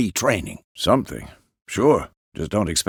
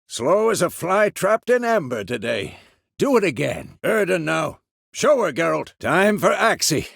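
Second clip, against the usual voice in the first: high, raised instructor's voice; calling out coaching; 7 semitones higher than usual. Acted. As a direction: coach a fighter from across the yard. Coaching sparring from a distance.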